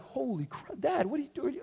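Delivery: put-on hushed, high-pitched startled child; shocked little kids. Little boys reacting to dad's yell, hushed shock. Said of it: "they looked at me kinda like".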